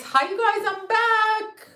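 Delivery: high-pitched greeting. Real, not acted. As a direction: pitch the hello way up, delighted. Greeting viewers, said twice.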